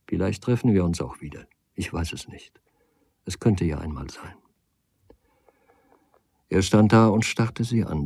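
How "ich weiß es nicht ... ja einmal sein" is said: hushed, unvoiced uncertainty; a whispered admission of doubt. A narrator's reading. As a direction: whisper it as if to herself. Soft parting words to suitor.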